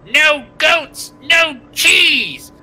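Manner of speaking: high, loud crone's shriek; hammed-up crone yelling in protest; yelling old crone. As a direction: shout it like an outraged old village woman. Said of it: "an old crone woman stands up".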